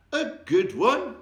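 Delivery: high, loud, slow indignant cry; outraged anyone could doubt it; cried out. A narrator's reading. Giant answers Sophie's question with a high, loud cry. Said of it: "he cried".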